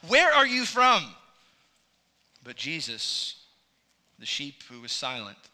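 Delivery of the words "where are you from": higher, louder exasperated demand; frustrated. Retold or imitated. Pilate pressing the silent prisoner, retold frustrated demand. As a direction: press him like an irritated governor. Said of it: "obviously frustrated now".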